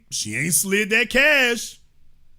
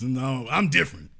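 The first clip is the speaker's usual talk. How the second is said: imitating Biden; 8 semitones lower, 4 dB quieter than usual. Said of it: "imitating Biden".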